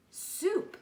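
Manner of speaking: snarled. Acted. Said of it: "snarled the scruffy varmint".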